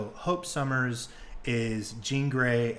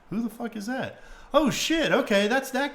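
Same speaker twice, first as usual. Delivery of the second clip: high-pitched puzzled question bursting into recognition; acted surprise; 10 semitones higher, 3 dB louder than usual. Host acting out a viewer spotting a character, surprised. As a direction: mimic a viewer suddenly placing a character.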